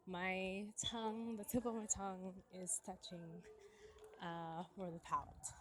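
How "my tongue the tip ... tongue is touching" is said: ordinary talk, nothing marked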